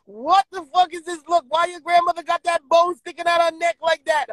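high, mock-shocked old lady voice; hammed-up granny shock at the picture; imitating grandmother. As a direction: squeal it like a startled grandma. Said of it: "she said".

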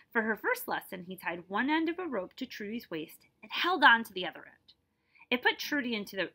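clear, lively read-aloud narration; story narration. A narrator's reading. Teacher reading a picture book to her class, lively narration.

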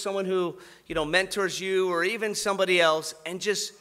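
usual speaking voice

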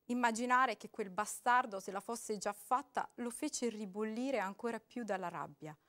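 normal talking voice

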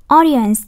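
AU-dience, first syllable loud; stress on first syllable. Shown as a demonstration. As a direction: say "Audience" slowly, hitting AU hard. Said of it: "nhắn âm một". (nhấn mạnh âm đầu của "Audience")

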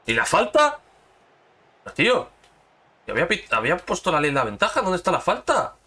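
loud angry protest at the referee, high-pitched; furious shout. Real, not acted. Player yelling at the game over a missing foul, furious. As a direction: yell at the game for a missed foul.

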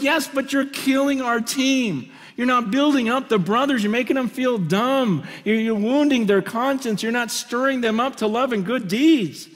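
high, pressing rebuke. Retold or imitated.

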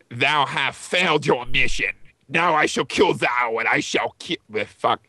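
low, menacing villain voice; Darth Vader voice. Acted. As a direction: growl it out like a dark lord with your nose plugged. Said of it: "in his raspy voice".

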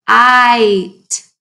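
K, then a long stretched ite; segmented kite. Shown as a demonstration. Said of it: "Do you hear the long I sound".

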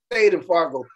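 usual speaking voice

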